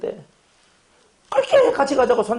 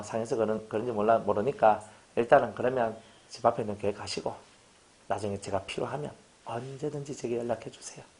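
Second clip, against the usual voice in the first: lower, much softer, reassuring pastor; gracious and gentle; 9 semitones lower, 9 dB quieter than usual. Retold or imitated. Pastor graciously blesses a deacon leaving, soft and reassuring. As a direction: let him go kindly, almost hushed. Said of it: "이렇게 말하는 게 격 있는 거".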